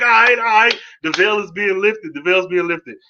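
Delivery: clapping fan crying out the veil is lifted; excited cheer. Retold or imitated. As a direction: cheer like the big reveal just landed. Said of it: "I'm clapping, like".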